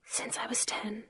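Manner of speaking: breathy, barely voiced confession; whisper. A narrator's reading. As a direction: whisper it, almost no voice.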